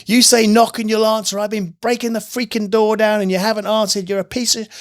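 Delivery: high-pitched retold rant at God; mock yelling. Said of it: "yelling at God".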